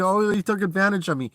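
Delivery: high, whiny mock of the hater's line; mocking the troll. Vlogger parrots the troll's complaint, whiny sneer. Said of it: "jump back on YouTube".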